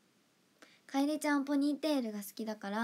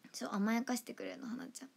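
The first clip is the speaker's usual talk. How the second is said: breathy whisper, fading to almost nothing; fond whisper; 6 dB quieter than usual. Real, not acted. Idol telling viewers Hana spoils her, whispered. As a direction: whisper it fondly, trailing off. Fond secret about a friend.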